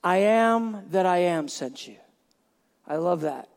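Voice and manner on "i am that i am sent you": God's answer. Retold or imitated. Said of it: "He says".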